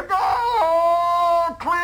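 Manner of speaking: high barked all-clear; called order. Retold or imitated. Sergeant calling the clear to the marching troops, high. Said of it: "Then you hear".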